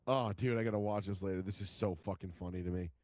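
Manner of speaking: ordinary delivery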